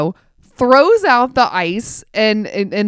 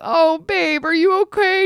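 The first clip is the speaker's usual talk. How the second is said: high, gentle worried question; concerned tender; 8 semitones higher than usual. Retold or imitated. Wife asking her sick husband if he is okay, tender.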